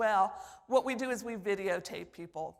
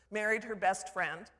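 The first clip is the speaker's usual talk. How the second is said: ordinary talk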